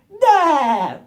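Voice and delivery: high, loud cartoon laugh attempt, cut short; failed SpongeBob laugh. Reviewer attempting SpongeBob's laugh, badly faked. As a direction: blurt a squeaky laugh and give up. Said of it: "I can't do a SpongeBob laugh".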